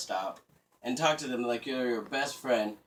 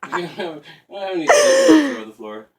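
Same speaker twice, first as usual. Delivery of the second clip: high, loud words through laughter; helpless laughing delivery; 11 semitones higher, 15 dB louder than usual. Real, not acted. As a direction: crack up while trying to finish the sentence. Cracking up at own joke.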